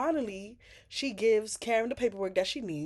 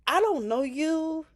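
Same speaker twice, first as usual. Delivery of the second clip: sassy retort; 8 semitones higher, 6 dB louder, slower than usual. Pretend. Mocking retort to realtor Chelsea.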